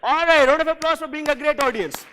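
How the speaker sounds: big booming call for applause; loud cheer. Real, not acted. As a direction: hype up the room at full voice. Host cheers the crowd.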